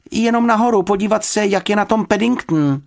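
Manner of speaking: put-on hurried-off child's reply, pitched high; child calling back. A narrator's reading. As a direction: toss a high, loud excuse over your shoulder. Child calls back while rushing out.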